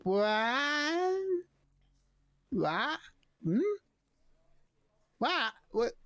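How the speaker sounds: high kobold sounding out a strange word; struggling to form words. Acted. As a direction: draw the word out, puzzled and high. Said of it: "he's trying to form the words in his mouth".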